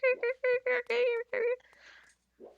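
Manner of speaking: high chirping cricket voice; cricket impression. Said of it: "That's my impression of a cricket".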